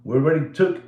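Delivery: normal talking voice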